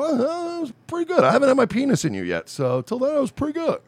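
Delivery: high-pitched imitation of false praise; put-on high voice, mocking a flatterer; mock polite praise. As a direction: mimic someone politely fibbing, pitch lifted. Mocks listener's praise of rapping.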